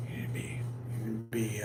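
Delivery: very soft, low near-whisper; low whispered caution; hushed warning. Real, not acted. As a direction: let the voice sink almost to nothing.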